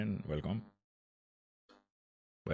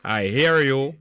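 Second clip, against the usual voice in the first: calling out; 4 semitones higher, 8 dB louder, slower than usual. Real, not acted. Caller can't hear on phone.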